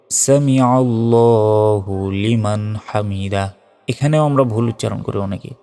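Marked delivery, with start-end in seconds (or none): 0.1-3.5 slow "সামিআল্লাহু", long rounded "ল্লা"; correct recitation. Shown as a demonstration. Teacher showing words for rising from ruku, slow recitation. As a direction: draw out "হামিদা" on the middle ee. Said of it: "ওঠার সময় কি বলতে হবে?".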